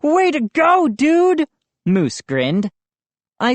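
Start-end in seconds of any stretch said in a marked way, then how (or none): 0.0-1.5 high loud praise; excited cheer. A narrator's reading. High hearty cheer. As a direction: Moose cheers Joe's prank, excited. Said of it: "Moose congratulates Joe".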